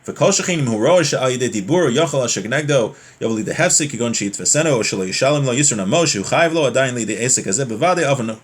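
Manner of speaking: normal talking voice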